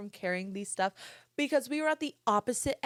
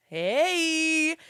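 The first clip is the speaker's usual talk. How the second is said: loud, high, long-drawn mock greeting; mocking drawl; 7 semitones higher, 6 dB louder than usual. Drive-through guy mocking her tired accent, exaggerated drawl. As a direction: mimic her slurred speech, pitched up and stretched. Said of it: "literally mocking the way I was speaking".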